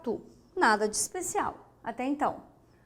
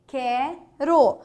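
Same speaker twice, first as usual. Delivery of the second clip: ordinary talk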